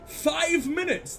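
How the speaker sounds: high, slow disbelieving echo of five minutes; "five minutes?" rising high and drawn out in shock; incredulous. Real, not acted. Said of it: "Spend five minutes speaking in".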